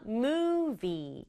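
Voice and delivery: "Movie" said slowly and clearly, long final ee; teacher modelling "Movie" for kids to copy; "Movie" with a stretched final vowel; model pronunciation. Shown as a demonstration. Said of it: "이렇게 발음해요".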